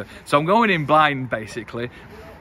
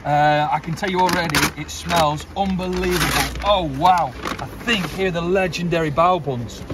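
ordinary delivery

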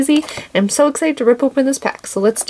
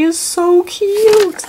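higher, slow, cutesy voice; cutesy gush; 8 semitones higher, much slower than usual. Real, not acted. Vlogger showing the cola toy, cutesy cooing. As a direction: coo over the little toy.

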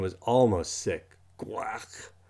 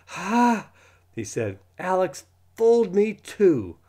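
indignant realization; 10 semitones higher, 7 dB louder, slower than usual. Acted. Boy realizes he was fooled.

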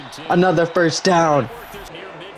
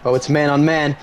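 ordinary delivery

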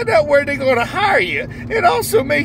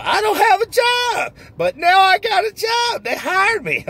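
overjoyed new hire; 7 semitones higher than usual. Acted. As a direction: squeal it out like the happiest man alive. Character answers the interviewer.

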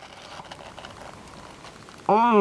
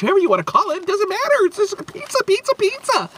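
very high, cartoon pizza mascot squeak; squeaky Little Caesars voice; 13 semitones higher, faster than usual.

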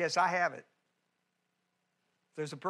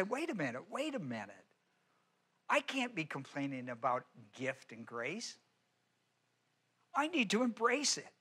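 soft self-correction; 2 semitones lower, 8 dB quieter than usual. Pretend.

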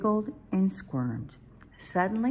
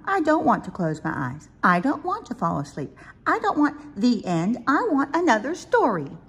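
put-on high, loud childlike whine of refusal; protesting little owl; 9 semitones higher, 6 dB louder than usual. Little Owl demands another story from Mommy, loud high protest. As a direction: protest bedtime in a small, insistent voice.

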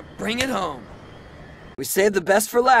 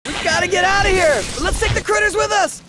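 high, fast, shouted alarm; urgent shout; 11 semitones higher, 6 dB louder, faster than usual. Acted. Skater warns team before explosion, urgent shout. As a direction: yell it as you run for it. Place about to blow up.

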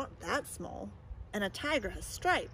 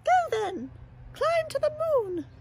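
high, mocking dare; squeaky teasing animal; high taunting voice; 6 dB louder than usual. Other animal taunts the mouse.

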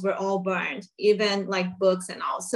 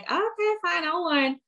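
resigned quote; 9 semitones higher than usual. Retold or imitated. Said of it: "And she was like".

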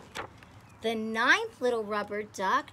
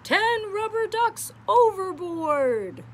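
high and slowed shouted call; 10 semitones higher, 6 dB louder, slower than usual. Acted. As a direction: call it out slowly across the deck. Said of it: "shouts the captain".

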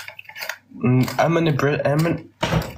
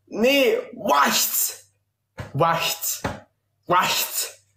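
high barking "wacht" repeated; mock aggressive Dutch; 10 semitones higher, much slower than usual. Shown as a demonstration. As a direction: snap the Dutch like it's harsh. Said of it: "In Dutch".

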